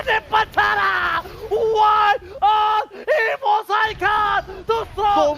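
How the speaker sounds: screaming the game-winning fight; shouting play-by-play. Acted. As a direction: yell every kill as it lands. Final teamfight casting.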